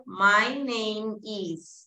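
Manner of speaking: "My name is" drawn out very slowly; very slow example. Shown as a demonstration. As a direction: stretch "My name is" for the class to copy. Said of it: "So, for example".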